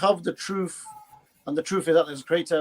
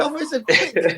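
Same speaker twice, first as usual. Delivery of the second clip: high, laughing put-on accent; laughing mock accent; 9 semitones higher, 5 dB louder, slower than usual. Mock accent, laughing at his friend's cake joke. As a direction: giggle through a silly accent.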